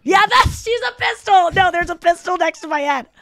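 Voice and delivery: high, loud burst of realization; excited realization. Real, not acted. Telling a friend the pistol finally makes sense, excited. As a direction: it clicks, blurt it out.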